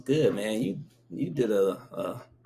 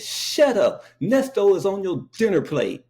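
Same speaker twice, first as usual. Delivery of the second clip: high, loud mocking blurt; cousin snapping; 9 semitones higher, 4 dB louder than usual. Cousin blurting at the dinner table, high and loud. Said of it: "my cousin jumped up and said".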